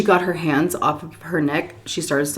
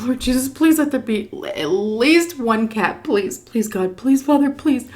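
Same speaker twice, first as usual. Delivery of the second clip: desperate prayer; 6 semitones higher than usual. Retold or imitated. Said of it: "like, praying".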